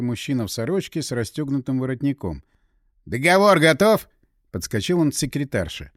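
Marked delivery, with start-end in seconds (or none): 3.1-4.1 loud, impatient boss's question; urgent demand. Acted. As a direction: burst in demanding an answer from the secretary. Boss bursts out, demands contract.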